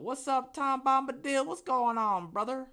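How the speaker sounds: high, loud, delighted hello; excited greeting. Real, not acted. Greeting viewer Tom Bombadil in chat, high and loud with excitement.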